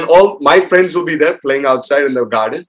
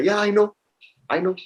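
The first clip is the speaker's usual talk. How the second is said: higher, softer know-it-all mom; imitating mom; 6 semitones higher, 3 dB quieter than usual. Child mocking mom's claim to know anything, high soft imitation. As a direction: lightly mimic mom breezily claiming to know. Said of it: "my mom will say".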